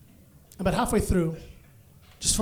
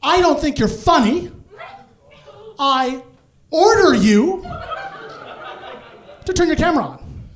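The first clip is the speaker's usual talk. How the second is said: higher, louder stern command; stern order; 9 semitones higher, 4 dB louder than usual. Retold or imitated. HR manager ordering him to turn on the camera, stern. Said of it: "She goes".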